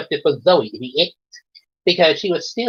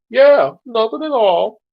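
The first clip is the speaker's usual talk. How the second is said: higher, slightly forced agreement; nervous chorus; 7 semitones higher, 4 dB louder than usual. A narrator's reading. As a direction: agree too quickly, pitched up. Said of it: "all the girls said in u-, in unison".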